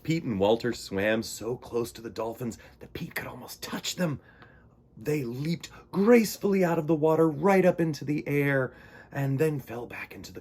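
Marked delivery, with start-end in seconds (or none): none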